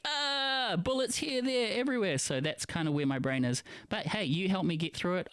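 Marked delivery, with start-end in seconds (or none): 0.0-2.2 high panicked cry. Retold or imitated. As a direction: shout it like you're under fire. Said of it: "we're like".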